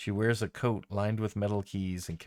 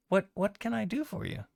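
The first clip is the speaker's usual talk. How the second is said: eager goblin; 12 semitones higher than usual. Acted. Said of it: "she goes".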